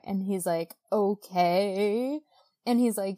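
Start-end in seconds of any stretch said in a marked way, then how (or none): 0.9-2.2 drawn-out, skeptical mock okay; dubious okay. Man answering her angel story, mock dubious. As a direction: stretch it out, humouring someone odd.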